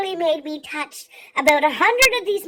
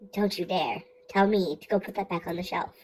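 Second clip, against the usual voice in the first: low threat; 9 semitones lower, 5 dB quieter than usual. Retold or imitated. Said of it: "I'm looking at her like".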